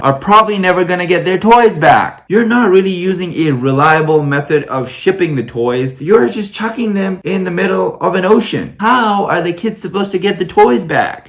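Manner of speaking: high-pitched exasperated rant; indignant scolding. Acted. Reviewer telling off Santa for dumping toys at sea, indignant scolding.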